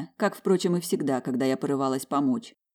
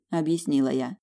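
ordinary delivery

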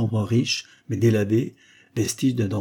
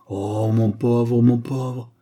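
slow, drawn-out lament, louder; Bark sighing; 2 semitones higher, 5 dB louder, much slower than usual. A narrator's reading. Lawyer pitying his coughing client, loud sighing lament. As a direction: heave a long, pitying sigh through the words. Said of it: "soupira monsieur Bark".

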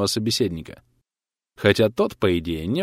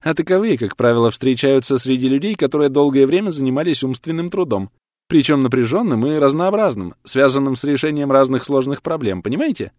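lightly voiced woman doctor, higher and louder; raised, emphatic explanatory voice; doctor explaining; 5 semitones higher, 5 dB louder than usual. A narrator's reading. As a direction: explain it earnestly to a patient. Female doctor explaining to the patient.

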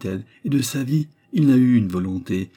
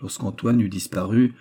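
ordinary delivery, nothing marked

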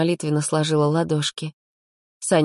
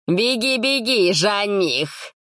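high, sing-song jeer; high teasing jeer; mocking taunt; 5 semitones higher, much slower than usual. A narrator's reading. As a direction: jeer at the suitor. Kirill taunts Sanya.

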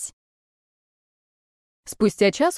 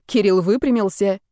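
plain reading voice

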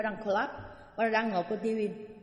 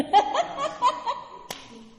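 a high burst of laughter at the story; 4 dB louder than usual. Real, not acted. Nun laughing at her own story, amused laugh.

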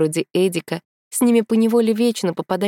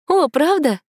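high surprised question; 10 semitones higher, 4 dB louder, slower than usual. A narrator's reading. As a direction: sound caught off guard. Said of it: "удивилась Тома".

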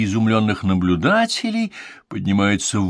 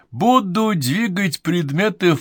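stage showman; 10 semitones higher, slower than usual. Acted. Medium resumes speech.

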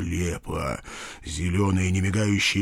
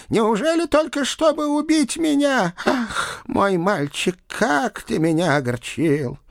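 put-on high, lamenting old-aunt voice; sighing, high-pitched disappointment; wounded aunt; 12 semitones higher than usual. A narrator's reading. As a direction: reproach him theatrically. Ghostly aunt reproaches her nephew.

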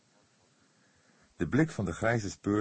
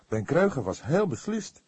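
ordinary delivery

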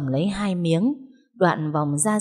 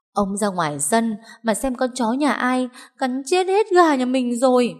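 high-pitched outcry, climbing toward the end; agitated complaint; 7 semitones higher than usual. A narrator's reading. Wife complaining to husband about the dead chickens, agitated outcry.

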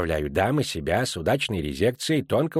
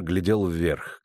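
usual speaking voice

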